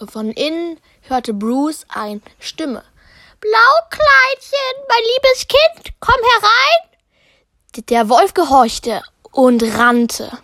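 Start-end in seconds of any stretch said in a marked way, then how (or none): 3.4-6.9 sehr hoch, langsam und lauter, alte Oma ruft; Großmutterstimme. Acted. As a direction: ruf süßlich wie eine alte Großmutter. Großmutter ruft aus dem Haus. (Großmutter ruft von innen, hohe alte Stimme)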